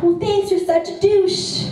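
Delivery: high, slow, sing-song thanks; sweetly signing off, meaning it kindly; mock sweet thanks. Thanking the lady who said no, high and slow.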